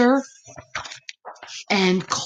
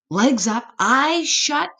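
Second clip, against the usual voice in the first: ordinary delivery, nothing marked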